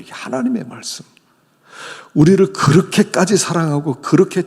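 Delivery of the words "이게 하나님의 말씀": ordinary talk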